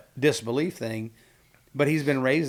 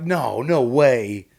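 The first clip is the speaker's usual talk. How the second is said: slow, drawn-out "no way"; playful stunned disbelief; joking disbelief; 6 dB louder, much slower than usual. Retold or imitated. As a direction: stretch it out, louder, incredulous. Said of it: "like, a joke".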